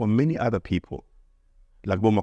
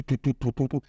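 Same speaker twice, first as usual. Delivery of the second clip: vocal fanfare. Pretend. Mimicking an entrance fanfare.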